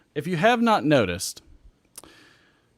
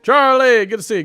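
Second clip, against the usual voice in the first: loud, high welcome; excited greeting; 11 semitones higher, 12 dB louder than usual. Real, not acted. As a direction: call out the name happily. Greeting viewer on stream.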